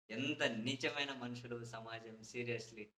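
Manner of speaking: dropping low and soft, slow and drained; weary disgust. Real, not acted. Commentator sinks, disgusted at society, low and soft. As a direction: let it sink, disgusted and tired. Sighing over society's meanness.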